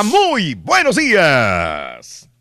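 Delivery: very slow, stretched good morning; drawn-out greeting. Real, not acted. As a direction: draw out every syllable. Host greets the next caller.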